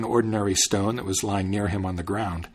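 ordinary delivery, nothing marked

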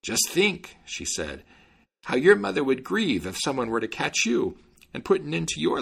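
put-on high mother duck begging for her children; imploring mother duck. A narrator's reading. Mother duck begs the tailor to spare her ducklings. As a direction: plead for the ducklings' lives. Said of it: "implored him to have mercy".

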